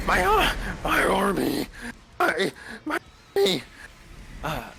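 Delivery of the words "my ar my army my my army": high, loud anguished undead cry; dark lich golem voice. Acted. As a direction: wail it like a dying lich. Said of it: "this dark lich golem voice".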